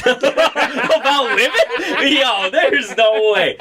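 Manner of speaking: high, drawn-out laughing disbelief; incredulous. Real, not acted. Accused of jokes about women, high laughing disbelief. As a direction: stretch it out, can't believe it.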